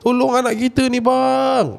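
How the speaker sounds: very high, drawn-out cry for help; screamed plea. Retold or imitated. Mother calling husband to help their child, high screamed plea. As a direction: wail desperately for rescue. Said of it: "saya terus jerit".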